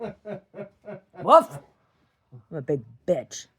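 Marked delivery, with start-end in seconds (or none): 0.0-1.6 mock bark. Imitating a dog bark.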